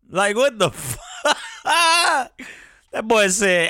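high, breaking into a laugh on the last word; drawn-out, incredulous laughing finish. Real, not acted. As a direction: crack up before you finish the line. Cracking up at his own story.

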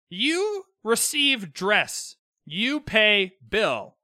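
very slow, word by word; mock simple explanation. Host spelling out the dressmaker's billing rule, slow and deliberate. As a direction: lay it out slowly, each word set apart.

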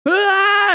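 high, short mock scream of fright. Recapping the anime, mimics Zenitsu screaming at a monster. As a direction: yelp like a spooked coward. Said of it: "He yelled out".

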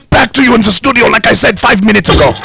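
squeaky sign-off pushed up high; high mock sign-off. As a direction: pitch it up and hurry. Villain sign-off, pitched up.

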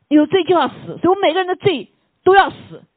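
rising, loud pulpit proclamation; forceful preaching. Real, not acted. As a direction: drive the verdict home at full voice. Preacher drives home sin's penalty.

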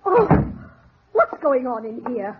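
normal talking voice

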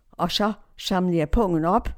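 normal talking voice

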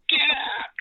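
high, loud shout of get out; angry shout. Retold or imitated. George pointing at the door, shouting angrily. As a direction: bark him out the door. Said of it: "He said".

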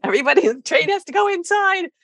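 put-on high, playful announcing to kids; playful train voice. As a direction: sing it out to the children. Playing trains at school.

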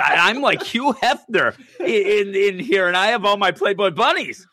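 high, fast, giggly bragging villain; hammed-up gleeful boast. Host voicing Mysterio bragging about his dream world, giddy and high. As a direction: cackle through the boast like a sleazy playboy.

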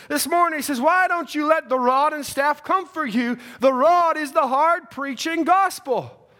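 high, loud, fervent sermon delivery; impassioned revival preacher; full-force preaching. Real, not acted.

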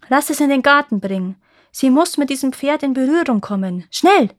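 pressing, commanding delivery; urgent command. A narrator's reading. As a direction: give urgent orders, building to the last word. Prince urgently orders the king.

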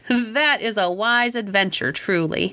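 plain reading voice

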